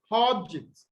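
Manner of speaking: OBject, weight on "ob"; first-syllable stress. Shown as a demonstration. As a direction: noun object, strong start and weak "ject". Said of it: "stress on the first syllable".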